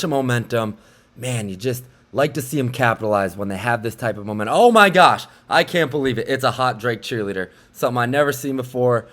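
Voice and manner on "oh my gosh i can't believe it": loud, high burst of disbelief; real surprise. Real, not acted. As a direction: caught off guard, blurting it out. Sudden sight during game footage.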